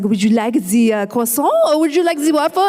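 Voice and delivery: hammed-up, deliberately bad French accent. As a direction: tease a child in mock French. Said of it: "I do some really bad accents".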